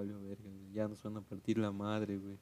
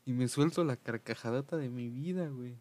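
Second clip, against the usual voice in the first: usual speaking voice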